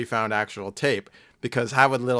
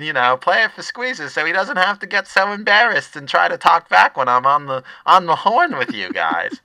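loud, high lunch lady; brash cafeteria lady calling out; 4 semitones higher, 5 dB louder than usual. Acted. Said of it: "I should just do both voices".

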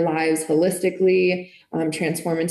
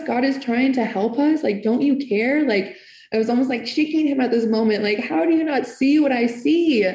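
urgent plea; 5 semitones higher than usual. Retold or imitated. Pleading argument with partner.

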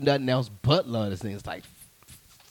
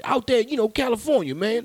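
put-on pitched up, pushy old man urging him on; hammed-up older man, higher and louder; older gentleman; 11 semitones higher, 7 dB louder than usual. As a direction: lean in like an insistent older guy hyping a young comic. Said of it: "that stage, stage where?".

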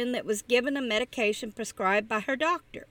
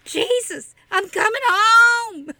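high, loud, slow crying shout; terrified passenger shrieking a prayer; 8 semitones higher, 7 dB louder than usual. Acted. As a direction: shriek it out in terror. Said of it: "let out a shriek".

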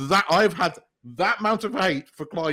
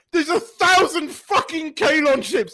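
high, loud comic outcry; mock alarm; 12 semitones higher, 7 dB louder than usual. Imitating the crew seeing the wedding guests arrive, mock alarm. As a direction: exclaim it in hammed-up disbelief.

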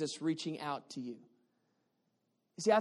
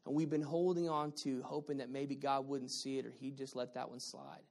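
hushed reflection; 7 semitones lower, 9 dB quieter than usual. Real, not acted. Soft reflective closing appeal.

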